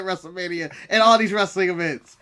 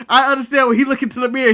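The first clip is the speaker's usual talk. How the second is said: ordinary talk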